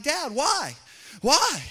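put-on high, loud kid pleading why; insistent child. Child asking dad after overhearing, high and loud. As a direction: whine it like a curious kid. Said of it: "they're like".

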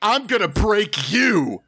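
drawn-out, showy battle cry; hero's threat. Acted. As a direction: threaten the villain theatrically. Hero threatens the villain.

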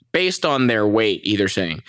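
ordinary delivery

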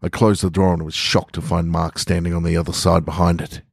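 plain reading voice

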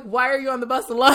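high, loud and quick, breaking into laughter; laughing question. Real, not acted. Storyteller retells asking a little old lady, laughing loudly. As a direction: crack up on the last word.

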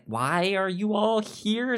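ordinary talk

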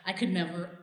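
soft, low and hushed; quietly choked up over the thought; emotional. Real, not acted. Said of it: "I'm getting really emotional".

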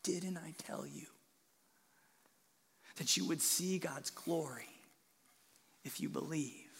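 soft, gentle whisper held close; tender whisper. Shown as a demonstration. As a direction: comfort her in a hushed voice. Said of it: "held her close, and he said".

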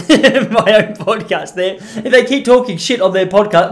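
laughing through the words; amused at own slip. Real, not acted. Podcaster catching his own Freudian slip, laughing as he corrects it.

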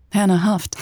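ordinary delivery

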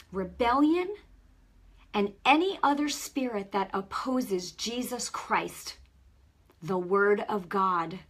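loud, emphatic prayer for repetition; fervent prayer. Shown as a demonstration. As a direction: lead the congregation's prayer line by line. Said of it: "you can just repeat after me".

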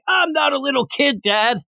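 high-pitched indignant son; mock childish protest. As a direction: protest to dad like a kid. Voicing son protesting to his father.

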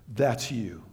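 low, soft voice of God speaking inwardly; quiet inner voice. Retold or imitated. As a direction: drop low and soft, like a voice in the heart. God's inner voice in scripture.

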